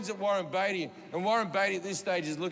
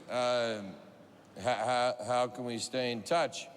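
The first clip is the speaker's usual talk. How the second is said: lower, halting, a touch confused; hesitant Beatty; 8 semitones lower, slower than usual. Retold or imitated. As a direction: fumble the question slowly.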